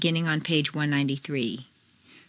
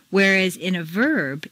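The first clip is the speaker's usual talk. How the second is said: usual speaking voice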